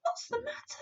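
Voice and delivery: put-on a worried little girl asks; girl's voice. A narrator's reading. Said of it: "Sophie asked".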